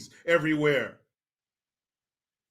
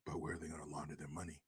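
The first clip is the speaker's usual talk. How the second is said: whisper; 25 dB quieter, much faster than usual. Real, not acted. Whispered aside.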